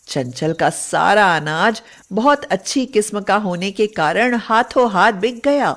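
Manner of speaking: plain reading voice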